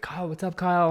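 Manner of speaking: mock shout of a buddy's name; calling out greeting. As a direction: hail a friend across a party. Party friends calling out greeting.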